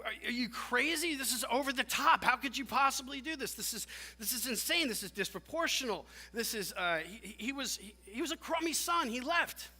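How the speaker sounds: high-pitched, exasperated outrage; incensed. Acted. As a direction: protest in disbelief as the wronged brother. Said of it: "the brother's incensed".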